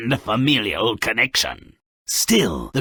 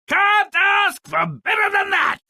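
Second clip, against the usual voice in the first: much higher, delighted exclamation; gleeful. Acted. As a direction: crow with delight at the doom. Gleeful follow-up.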